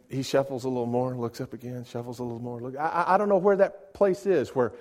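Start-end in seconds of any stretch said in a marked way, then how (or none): none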